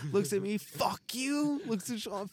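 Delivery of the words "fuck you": mom cursing. Retold or imitated.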